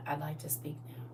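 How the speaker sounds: near-whispered calm request; quiet, repeated request. Shown as a demonstration. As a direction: keep it hushed under the yelling. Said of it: "just keep saying".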